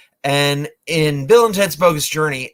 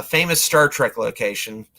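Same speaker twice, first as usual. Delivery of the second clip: usual speaking voice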